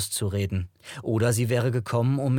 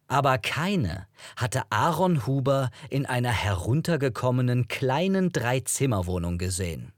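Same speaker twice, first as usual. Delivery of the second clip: usual speaking voice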